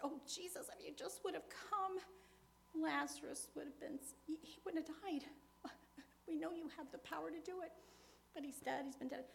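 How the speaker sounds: high, pleading lament to Jesus; Martha pleading. Retold or imitated. Martha grieving to Jesus over Lazarus, high pleading. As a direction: beg him why he came too late. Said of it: "and I said".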